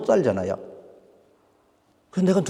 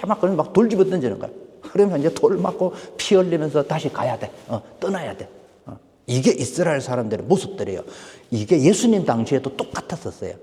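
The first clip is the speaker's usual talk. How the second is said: ordinary talk, nothing marked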